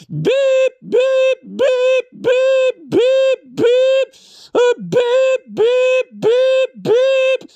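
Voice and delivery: bleep it out like a censor tone; censor beep. Pretend. Bleeping out swear words in mock outrage.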